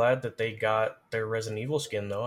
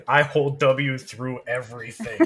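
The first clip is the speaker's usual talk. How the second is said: laughing joke; 3 semitones higher, 6 dB louder than usual. Acted. Joking about mindless play.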